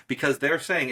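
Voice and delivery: ordinary delivery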